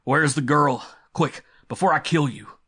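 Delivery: loud, high-pitched angry demand; snarled threat. A narrator's reading. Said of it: "and snarled".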